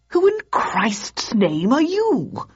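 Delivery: high, drawn-out incredulous demand; slow, high-pitched affronted question; indignant shock. A narrator's reading. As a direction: stretch it out, voice leaping up. Startled Hobbs challenges Grace Poole.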